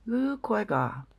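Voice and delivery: high, hooting call of Kwaiga; imitating Sabe call. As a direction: mimic a creature luring someone in, pitched high. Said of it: "is said by one of the Sabe".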